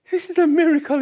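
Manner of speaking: king gasps at the miracle, amazed. Acted.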